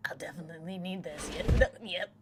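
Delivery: normal talking voice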